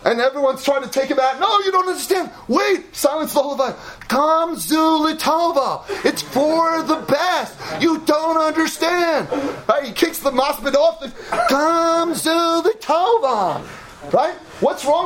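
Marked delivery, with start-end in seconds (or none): 1.4-9.3 mock fervent protest. Laughing man protests at funeral. 11.5-13.6 very slow, higher, hammed-up proclamation; mock ecstatic cry. Same funeral believer cries out.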